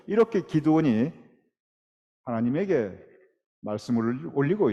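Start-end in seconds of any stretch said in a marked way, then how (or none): none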